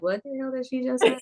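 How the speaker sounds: mock bafflement. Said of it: "you be sitting there like".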